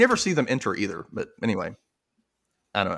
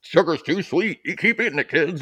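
higher, sing-song imitation; playful mimicry of a children's song; mock singer; 6 semitones higher than usual. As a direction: put on a silly raised voice. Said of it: "and he's over here".

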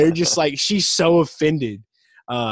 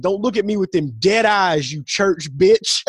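higher, louder mock scolding; retold angry insult; 8 semitones higher, 5 dB louder than usual. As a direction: lash out in a hammed-up quarrel.

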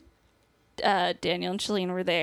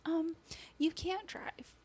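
retold gentle whisper; 8 semitones higher, 9 dB quieter, slower than usual. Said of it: "whispering to each other".

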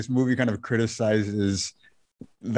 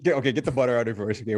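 normal talking voice

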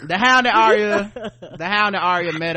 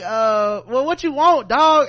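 voicing Jaime; 5 semitones higher, 5 dB louder than usual. Jaime voiced in retelling.

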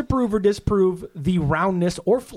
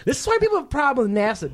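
raised pitch, quick outraged line; higher, faster exasperated; 8 semitones higher, faster than usual. Shown as a demonstration. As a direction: say it fast and high, fed up. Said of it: "depending how it's said".

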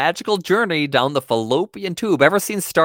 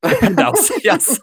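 laughing; 10 semitones higher, 3 dB louder than usual. Real, not acted. Laughing at the made-up word.

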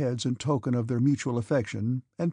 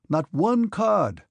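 high, slow, emphatic cry; exasperated; 7 semitones higher, 4 dB louder, much slower than usual. A narrator's reading. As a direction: stretch each word in outraged disbelief. Denisov exclaims over his losses.

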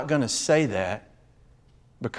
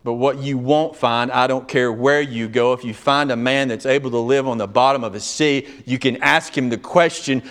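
ordinary delivery

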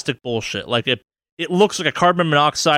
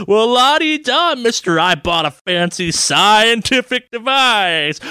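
high, slow, sneering mockery; mock it like a smug know-it-all; 6 semitones higher, slower than usual. Mocking his own brain's voice.

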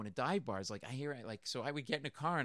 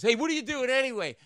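put-on drunk guy; 10 semitones higher, 9 dB louder than usual. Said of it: "he goes".

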